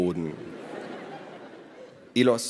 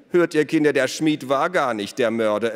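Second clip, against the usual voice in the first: louder, lifted ironic proclamation; mock announcement; 5 semitones higher, 6 dB louder than usual. A narrator's reading. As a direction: announce it to the children with mock amazement. Elos mockingly announces to his children. (spöttisch verkündet)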